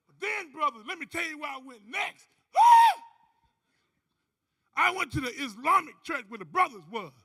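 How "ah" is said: high, loud excited shout. Acted. Preacher hyping the audience for the next story, loud shout. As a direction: holler to hype the crowd.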